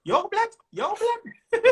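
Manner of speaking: put-on high, excited Mancunian accent; Manchester accent. Manchester crowd hailing the comedian, excited local accent. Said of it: "I hear the accent".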